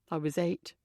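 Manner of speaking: low, soft, flat; flatly. A narrator's reading. Tom tells Emily his age, flat and low. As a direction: drop it dead and quiet. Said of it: "he says flatly".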